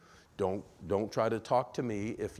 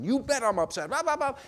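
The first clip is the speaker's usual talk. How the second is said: much higher, loud upset outburst; angry retort; 11 dB louder than usual. Acted. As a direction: snap back like an upset person. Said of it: "answer you from their emotional brain".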